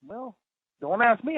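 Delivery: normal talking voice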